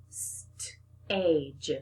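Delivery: St-age with the st split from age; split apart. Shown as a demonstration. Teacher breaks the word apart for the children. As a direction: hold St, then age. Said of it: "break apart that st".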